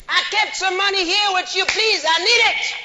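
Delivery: high, pleading mock customer voice; hammed-up high whiny plea for money; pleading bank customer. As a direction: beg the bank in a squeaky falsetto.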